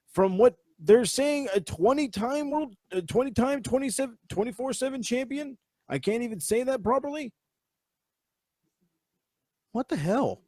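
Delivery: high-pitched disbelief tripping over the words; incredulous. Real, not acted. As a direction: climb up in pitch, baffled by the number. Incredulous at title count.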